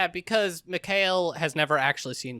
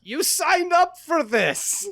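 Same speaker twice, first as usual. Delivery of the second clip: mock tough soldier; 13 semitones higher, 6 dB louder, slower than usual. Voicing Leo's blunt war stance.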